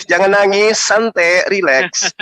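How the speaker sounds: teasing reassurance. Real, not acted. Host taunts debate opponent live not to cry, teasing. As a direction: needle them while telling them to relax.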